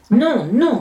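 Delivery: high, loud protest; ogresses' loud, high objection; crying out. A narrator's reading. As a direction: call out in protest like children. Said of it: "crient les ogresses".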